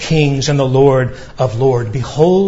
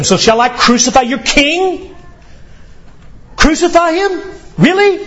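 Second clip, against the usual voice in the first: higher and higher, mocking disbelief; taunting Pilate; 11 semitones higher than usual. Pilate taunting the crowd about their king, mocking rise. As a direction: needle the crowd with each question. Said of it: "Pilate taunts them".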